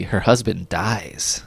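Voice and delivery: slow, soft and ominous; vintage horror narrator. Acted. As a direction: drop it low and slow, like a horror host. Ominous end of episode teaser.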